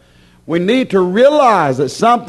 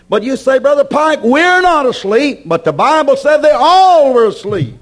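high, forceful pulpit argument; emphatic preaching; 8 semitones higher, 4 dB louder than usual. Acted. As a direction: voice the objection high, then overrule it. Preacher rebuts with raised voice.

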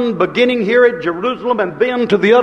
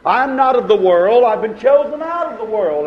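ordinary talk, nothing marked

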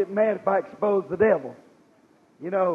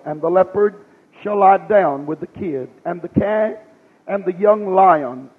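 measured, declaimed Bible reading; scripture reading; 2 semitones lower, 3 dB louder than usual. Real, not acted. Preacher reading Isaiah to the congregation, formal scripture reading. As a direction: read the verse out in weighty phrases, falling at the close.

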